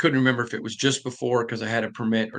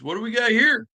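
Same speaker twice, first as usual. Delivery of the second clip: higher, mock-baffled dad asking; lightly voiced dad, pitched up in disbelief; dad's surprised question; 10 semitones higher, 4 dB louder than usual. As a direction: play the dad finding the door smashed in. Dad sees car through garage door.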